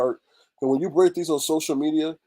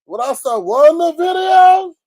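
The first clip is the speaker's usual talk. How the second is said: much higher and louder, stretched out; excited shout; 14 semitones higher, 11 dB louder than usual. Retold or imitated. To viewers about the video, loud excited shout. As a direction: shout the story start like big news.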